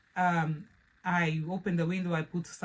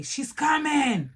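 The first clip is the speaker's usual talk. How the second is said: a slow, high warning call, louder than her usual talk; mock alarm; 8 semitones higher, 5 dB louder, much slower than usual. Relatives warning each other she is coming, in mock alarm. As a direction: sound the alarm that the wild child is on her way. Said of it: "people say".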